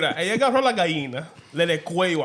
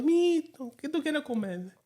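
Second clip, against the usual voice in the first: put-on higher, sweet offer to a little kid; lightly voiced relative, pitched up; kind relative asking; 10 semitones higher, 3 dB quieter than usual. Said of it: "And he goes".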